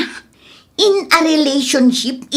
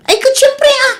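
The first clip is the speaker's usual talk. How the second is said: laughing insistence; 12 semitones higher, 3 dB louder than usual. Acted. Insists the debt must be paid.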